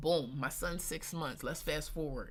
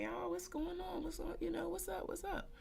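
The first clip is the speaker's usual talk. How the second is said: much higher, softer hellos; acted casual greeting; 11 semitones higher, 5 dB quieter than usual. She walks in on her homegirls, high soft acted greeting. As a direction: re-enact light, high-pitched greetings. Acts greeting friends at weird gathering.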